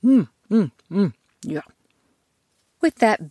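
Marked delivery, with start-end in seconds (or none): 0.0-1.6 satisfied eating hums. Acted. Eating porridge happily.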